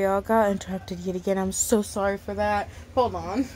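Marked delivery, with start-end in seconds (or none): none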